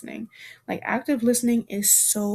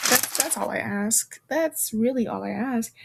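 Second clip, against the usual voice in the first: ordinary delivery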